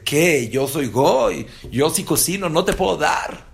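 high, loud mock indignation; indignant outburst. [name] mocking the religious man's refusal, loud and indignant. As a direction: throw ¿Qué? Yo soy goy back at them loudly.

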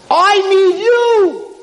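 very high, loud, drawn-out plea; king telling the boy he needs him; emphatic plea. Acted. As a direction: stretch it out with feeling. King's heartfelt plea.

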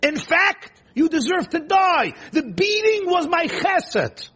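furious self-righteous rider; outraged accusation. Acted. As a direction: hurl the blame back. Bilam's self-righteous rant at donkey.

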